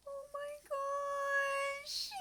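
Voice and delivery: high, slow, choked-up oh my gosh; voice breaking. Real, not acted. Streamer moved by her snowflake reveal, voice breaking. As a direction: let the words crack with real emotion. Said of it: "I don't mean to be so emotional".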